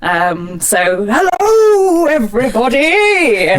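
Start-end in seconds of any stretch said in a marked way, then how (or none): 1.1-3.5 booming tour guide greeting; Viking voice. Shown as a demonstration. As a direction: call out slow to school kids. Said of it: "it's a Viking voice".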